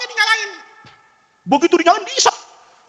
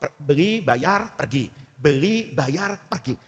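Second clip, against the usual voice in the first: ordinary talk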